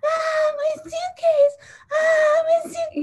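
high, loud mock scream of a little girl; screaming child imitation. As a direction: wail it drawn-out and shrill like a screaming kid. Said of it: "like, screaming".

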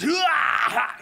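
high, loud karate kiai; karate shout. Retold or imitated. Kid landing a karate move with a loud kiai. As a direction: yell it like a martial arts strike.

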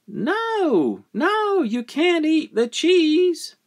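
high, emphatic mother's scolding; high-pitched motherly no; mother forbidding. Acted. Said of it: "the mother said".

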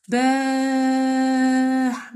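باح with the long aa held very long; held madd demo; long vowel. Shown as a demonstration. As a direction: stretch the vowel out to show the madd. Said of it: "مد مع ممدود".

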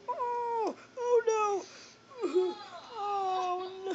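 high falsetto whimper; hammed-up, drawn-out crying in falsetto; mock wailing. Mock grief over a killing in game.

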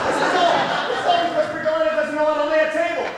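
high, loud, rapid protest; indignant blame. Acted. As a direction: blurt out the excuse, loudly passing blame. Servant blames someone, defending himself.

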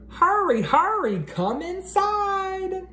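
high excited call, slowing on come inside; excited little bunny. Acted. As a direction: squeak it out like a thrilled little kid.

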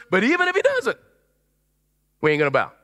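cocky defiance of a tyrant; defiant. Retold or imitated. Three Hebrews defying the king, sharp rise then flat refusal. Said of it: "smart aleck responses".

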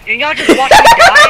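high, loud disbelieving yell with a laugh; laughing outraged shout. Real, not acted. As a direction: shriek at friends who let you die.

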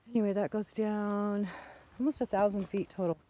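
ordinary talk

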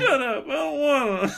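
high-pitched tearful whine; mock crying. Corin refusing to get dressed, mock tearful whine. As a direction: squeal it like a pretend crybaby. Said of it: "Corin starts to cry".

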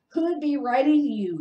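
loud, high sneering question. A narrator's reading. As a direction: sneer it down at him. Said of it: "sneered Uncle Vernon".

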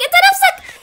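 نفسك squealed very high and loud; shocked shriek. Real, not acted. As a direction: scream انت نفسك in horror. Shrieks the realization.

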